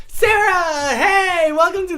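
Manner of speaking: very high, loud, delighted welcome; excited shout. Real, not acted. Streamer greeting Sarah joining the party, excited shout. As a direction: call out like a friend just walked in.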